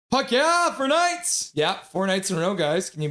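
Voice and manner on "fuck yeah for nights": high, loud celebratory yell; acted cheer. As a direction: whoop it like a hyped-up fan. Reads an excited chat cheer.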